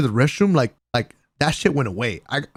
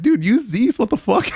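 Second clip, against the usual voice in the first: incredulous; 11 semitones higher, 4 dB louder than usual. Retold or imitated. Retold handing over tickets.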